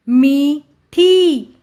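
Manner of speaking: मी and ठी slow and separate; sounding out. Shown as a demonstration. Teacher reading to children, syllable by syllable. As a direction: stretch both halves: मी... ठी. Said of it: "उच्चारण करके पढ़ लिया".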